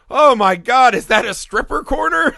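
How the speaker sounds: high, loud gasping wonder; mock awestruck kid. Imagining a stripper corner in the candy land, high amazed cry. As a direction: gush like a kid seeing paradise. Imagining a kid's dream place.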